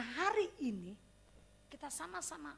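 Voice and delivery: ordinary talk, nothing marked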